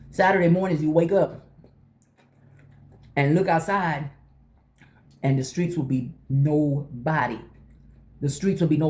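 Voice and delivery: normal talking voice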